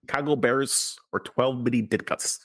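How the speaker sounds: hammed-up Chicago Bears fan; mock Chicago local; Chicago accent. Shown as a demonstration. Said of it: "Is this a good Chicago accent?".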